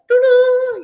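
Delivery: high, loud sing-song doo-doo; playful brushing hum. Shown as a demonstration. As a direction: hum the toothbrush sound brightly and playfully. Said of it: "you could do it like that".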